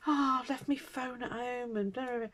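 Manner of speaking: high, sheepish confession; mock sheepish. Mother telling daughter she forgot her phone, high sheepish. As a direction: own up like a scatterbrain. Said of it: "So I said to her".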